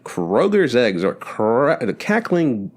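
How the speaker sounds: slow, hammed-up commercial voice; cheesy ad read. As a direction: drag it out like a corny egg commercial. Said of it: "the audio is super cheesy".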